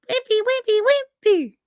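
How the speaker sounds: very high, tiny mock voice; squeaky wimp. Reciting the hefty and wimpy slogan, squeaky wimp voice. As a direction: squeak it out like a puny weakling.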